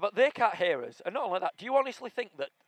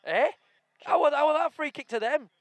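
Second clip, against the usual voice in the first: outraged; 11 semitones higher, 7 dB louder than usual. Real, not acted. Outraged at free kick.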